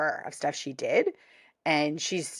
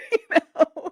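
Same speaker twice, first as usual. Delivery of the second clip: usual speaking voice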